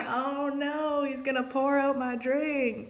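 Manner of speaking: high mock-panicked voice; playful alarm; mock worry. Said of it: "I'm like".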